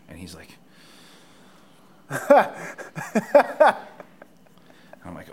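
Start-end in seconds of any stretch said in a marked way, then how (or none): 2.1-4.2 high wheezing laugh; loud burst of laughter. Real, not acted. Loud laugh at his reply.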